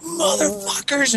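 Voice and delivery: high, drawn-out mock scream; strained, high-pitched mock fury; retold scream. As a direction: act out a rage outburst without full volume. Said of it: "like, screaming in front of my parents".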